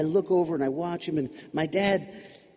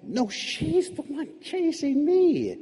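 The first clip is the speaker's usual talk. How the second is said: high, slow, drawn-out objection; indignant protest; 10 semitones higher, slower than usual. Acted. Child correcting Dad about who is chasing, indignant protest. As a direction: protest it slowly, pitched way up.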